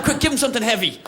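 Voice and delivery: a flustered fighter barking a quick order, hammed-up; mock urgent panic.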